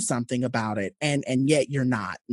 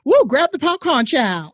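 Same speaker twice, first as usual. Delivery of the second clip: playful cheer; 10 semitones higher, 6 dB louder than usual. Real, not acted. Teasing cheer before the story.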